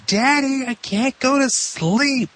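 put-on high, slow whiny child; little girl voice. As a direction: whine it like a kid who can't sleep. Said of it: "she's just in her bed yelling and screaming".

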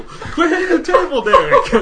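high, laughing scold. Real, not acted. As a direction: try to scold through laughter. Laughing while scolding friend.

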